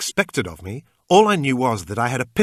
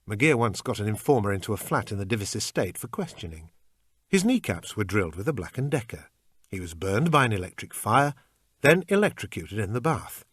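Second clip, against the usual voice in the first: usual speaking voice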